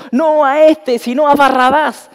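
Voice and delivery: a preacher voicing the shouting crowd. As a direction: shout it as the mob.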